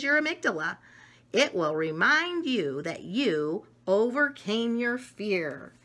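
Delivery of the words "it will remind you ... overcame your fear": ordinary delivery, nothing marked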